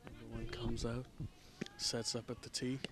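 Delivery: very soft, hushed golf commentary; golf announcer voice. Acted. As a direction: murmur it like a quiet golf broadcast. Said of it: "Time for the golf commentary".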